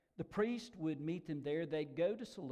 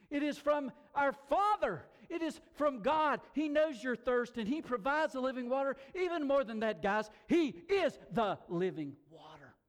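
raised, emphatic pulpit voice; fervent preaching; 5 semitones higher, 3 dB louder than usual. Real, not acted. Preacher proclaiming a free invitation to the thirsty, fervent. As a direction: proclaim it with ringing conviction.

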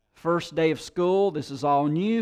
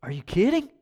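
put-on a child's high incredulous question; lightly voiced kid in disbelief; 3 semitones higher, faster than usual. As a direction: ask it like a stunned first grader. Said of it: "in disbelief".

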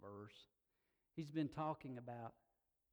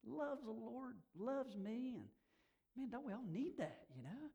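higher, emotional praise; moved; 8 semitones higher than usual. Real, not acted. Preacher moved by his lifelong prayer partner, emotional. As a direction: praise a dear friend while close to tears.

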